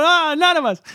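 high-pitched fake innocence; kids' mock denial. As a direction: play guilty kids denying it all. Kids deny it to lady.

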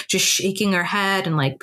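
ordinary talk, nothing marked

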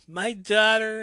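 put-on high, loud anguished scream for her child. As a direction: scream it out in grief. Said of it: "Liberty screams".

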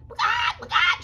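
loud, high hen squawks; excited hen squawk. Acted. Henny Penny gets all excited, squawking. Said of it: "She got all excited".

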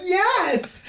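very high, chirpy yes; innocent yes. Retold or imitated. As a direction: answer brightly without a clue. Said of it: "We're like".

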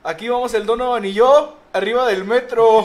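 high, loud showy call; playful loud proclamation; mock performer's shout. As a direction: belt it out like a video shout-out.

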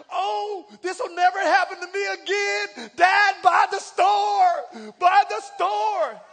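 put-on loud, slow, pleading child begging dad; mock greedy kid. Kid begging dad to buy the store, loud and slow. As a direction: beg for the whole store, drawn out. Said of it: "poverty mentality".

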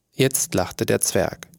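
ordinary delivery, nothing marked

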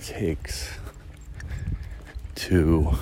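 trailing off into a whisper. Real, not acted. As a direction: let the words fade to breath. Voice drops away at the end.

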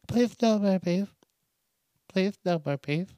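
put-on whiny kid Billy. Podcaster voicing Billy begging to skip peas, whiny child. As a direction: beg like a little kid who hates peas. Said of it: "maybe I'm Billy".

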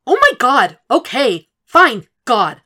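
very loud, high, exploding; furious daughter ending the call; exasperated yelling. A narrator's reading. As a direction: shout as you hang up. Hanging up in fury.

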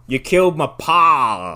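slow, grim hero voice; slow vengeful line. Retold or imitated. Hero telling the dying villain, slow grim revenge voice. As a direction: drag it out like a western avenger. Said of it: "then he's like".

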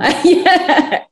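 short high-pitched laugh; real laugh. Real, not acted. Panelist laughing after her own aside.

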